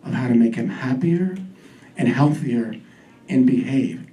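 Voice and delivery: low, halting pronouncement with pauses; low-pitched mystic, phrase by phrase; psychic medium voice. Retold or imitated. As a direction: deliver a vision like a medium. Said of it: "She has this very medium voice".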